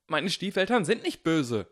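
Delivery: sudden loud protest, stress on nicht; blurted outburst. A narrator's reading. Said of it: "platzte es aus Harry heraus".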